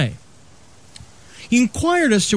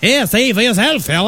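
exaggerated Maine feller voice; horrible Maine accent; 6 semitones higher than usual. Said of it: "That horrible accent".

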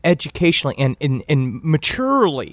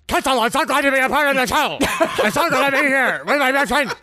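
high, fast, chattering skeleton voice; 8 semitones higher, faster than usual. Shown as a demonstration. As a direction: rattle it out like a goofy skeleton. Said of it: "my skeleton voice".

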